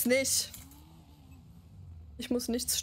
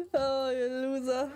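mocking laugh; slower than usual. Real, not acted. Mocking the pursuers.

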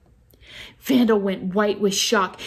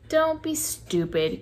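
growled; 6 semitones higher than usual. A narrator's reading. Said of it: "growled Vandal".

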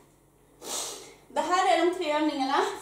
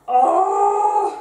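effort groan; 7 semitones higher, 8 dB louder than usual. Real, not acted. Straining through a hard exercise.

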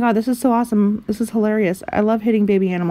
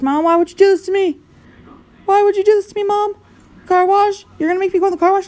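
high, loud whiny protest; mock outrage; 10 semitones higher, 4 dB louder, faster than usual. Kid whining at mom over the car wash, mock outrage. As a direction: complain to mom like a betrayed kid.

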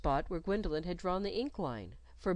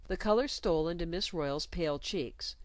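plain reading voice

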